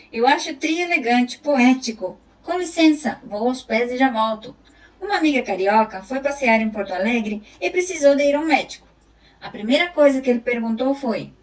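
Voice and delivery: storyteller reading a funny Gaúcho chronicle; reading a text aloud. Acted. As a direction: perform the chronicle for the class. Reads a humorous text aloud.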